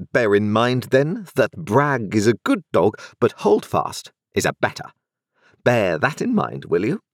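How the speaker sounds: a crisp, authoritative London lawyer; stern lawyer voice. A narrator's reading.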